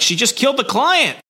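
high, frantic report; panicked Tongar. Retold or imitated. Tongar radioing the bad news, high and frantic. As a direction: blurt it out in panic. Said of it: "he's like".

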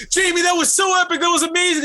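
high, loud gushing shout; excited cheering. Retold or imitated. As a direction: whoop it up as if thrilled by the stunt. Excited retelling to hurt wife.

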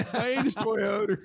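drawn-out Baltimore ad voice, half laughing; Baltimore accent. Retold or imitated. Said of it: "the Baltimore accent".

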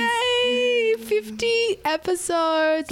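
high, drawn-out cheer. Real, not acted. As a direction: cheer slowly and brightly. Cheers the milestone.

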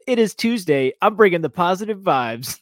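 mock upbeat host. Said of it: "You came in nasty on Patreon".

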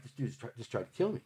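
stunned, hushed inner thought; quiet. Retold or imitated. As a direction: murmur it to yourself in shock. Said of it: "I'm like".

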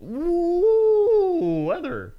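swooping high ooh then weather. Pretend. Weatherman intro.

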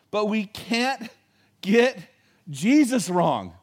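slow emphatic preacher's warning; emphatic point. Acted. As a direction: hammer the point home. Key point of sermon.

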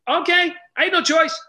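high, loud squeak of helpless agreement; mock-helpless high okay; reluctant okay. Reluctant agreement to pastor friend.

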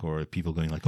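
ordinary talk, nothing marked

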